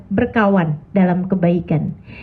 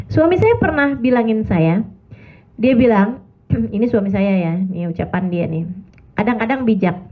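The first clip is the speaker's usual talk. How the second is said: usual speaking voice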